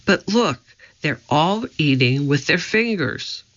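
plain reading voice

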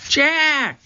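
high shout of his name; loud cry. A narrator's reading. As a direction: shout for help. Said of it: "Her voice was louder".

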